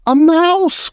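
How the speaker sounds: high, delighted witch cry; thrilled witch chorus; delighted witches. Acted. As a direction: shriek with delight. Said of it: "cried the witches".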